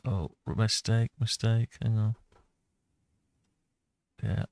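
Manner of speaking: normal talking voice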